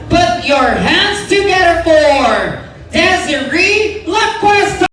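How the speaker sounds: high, slow announcer build to her name; grand entrance announcement. Acted. Host introduces celebrant.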